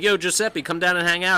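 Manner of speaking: high, loud call to a friend; hollering for a buddy to come out. Retold or imitated. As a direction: yell up to the house. Calling friend out to hang.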